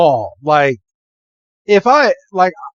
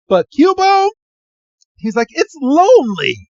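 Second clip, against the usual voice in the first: much higher, louder whimpering plea; hammed-up pathetic plea; squeaky plea; 12 semitones higher, 3 dB louder than usual. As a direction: plead in a silly squeak. Jack pleading in retold scene.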